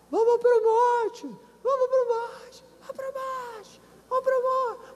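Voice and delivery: high call growing distant; like a message passed further off; fading echo. Retold or imitated. Echoed relay fading. (eco se afastando)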